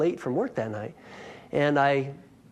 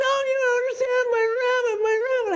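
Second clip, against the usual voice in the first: imitating wailing neighbor; faster than usual. Neighbor finds her dead rabbit on the porch, mock sobbing wail.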